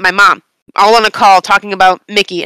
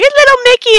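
squealed way up high over the puppy; cooing at the dog; 14 semitones higher, 3 dB louder than usual. Retold or imitated. Owner cooing at her new dog, high excited squeal. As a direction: gush up high, baby-talk style.

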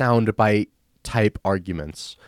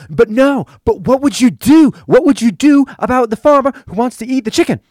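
higher and louder, hammering the question; frantic mock yell; 13 semitones higher, 8 dB louder than usual. Teen girl yelling at the cousin to answer, frantic mock yell. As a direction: imitate a teen girl losing it over a chicken. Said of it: "that causes her to go insane".

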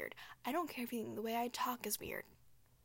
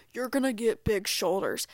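mock gym bro; 8 dB louder than usual. Mocking the men at her gym.

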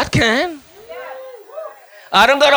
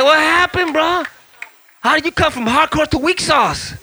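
high-pitched mock disbelief; acted-out indignant astonishment; incredulous challenge; 8 semitones higher than usual. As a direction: demand an explanation, baffled. Mock challenge to wimpy Christians.

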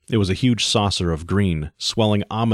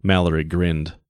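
ordinary delivery, nothing marked

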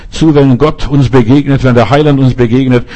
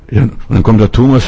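normal talking voice

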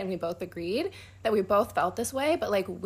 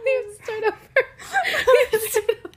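laughing; 8 dB louder, faster than usual. Real, not acted. Giggle fit at restart.

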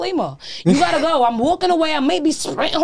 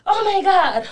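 higher, eager mock seducer; pushy come-on voice; 7 semitones higher than usual. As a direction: lay on the cheesy line. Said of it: "I can't sit here and be like".

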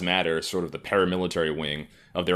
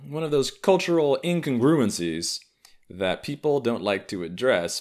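ordinary talk, nothing marked

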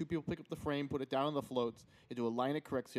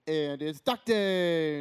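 teasing; 2 semitones higher, 8 dB louder, much slower than usual. Pretend. Teasing repeat, then "not really.

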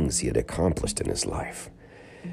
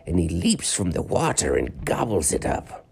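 an ancient sage telling lore; old man voice; 8 semitones higher than usual. Acted. Old man explains legend.

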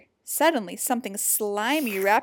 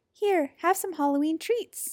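little voice; 7 semitones higher than usual. A narrator's reading. Little creature reassures Jim, small high voice. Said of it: "a little voice said".

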